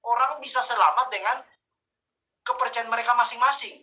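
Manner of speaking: high, insistent pulpit voice; decry the false teaching before the congregation; emphatic preaching. Real, not acted.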